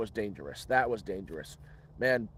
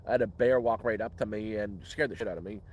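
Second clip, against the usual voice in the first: normal talking voice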